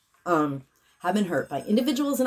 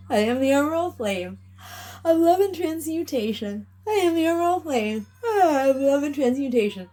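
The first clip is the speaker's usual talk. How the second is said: ritual incantation; 8 semitones higher than usual. Acted. Healer proclaims the clearing to the collective, raised invocation voice. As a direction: command the energy like a spell.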